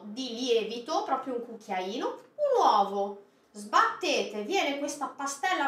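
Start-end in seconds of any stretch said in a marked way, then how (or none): none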